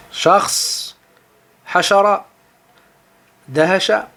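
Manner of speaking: lire Shars, hashara, dahasha à l'arabe; mots arabes prononcés. Shown as a demonstration. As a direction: articuler chaque mot arabe avec soin. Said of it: "Donc tous ces mots-là". (prononciation arabe appliquée)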